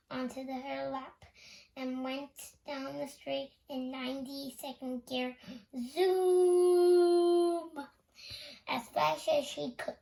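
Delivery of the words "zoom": long loud high zoom; zoom sound. A narrator's reading. Loretta's wheelchair speeding off, loud high zoom. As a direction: stretch the zoom like a racing engine.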